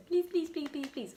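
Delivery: high-pitched, quick-fire begging; playful pleading. Pretend. Asking John Deere for a little yellow tractor, playful pleading. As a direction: beg cutely, tumbling the words out.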